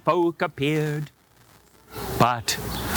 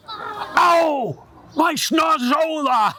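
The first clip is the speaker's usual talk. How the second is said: hurt fox yelping; 12 semitones higher, slower than usual. Acted. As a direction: howl over a bonked nose. Fox bonked on the nose.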